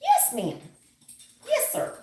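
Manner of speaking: high, prim yes ma'am; polite professional voice. Pretend. Reader showing the client a proper work voice, politely prim. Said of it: "your best professional voice".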